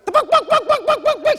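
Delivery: high, loud clucking burst like a hen; chicken squawk. Acted. Comic at the mic bursts into a high squawking cluck.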